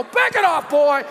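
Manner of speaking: high, urgent retold rebuke; mock scolding. Dad to his interrupting son, comic high-pitched scolding. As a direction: shoo the kid away in comic exasperation.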